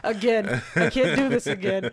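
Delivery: loud, laughing protest; overwhelmed, laughing through it; laughing distress. Real, not acted. Friend overwhelmed by retelling her grief, laughing distress. Upset, laughing at renewed tears.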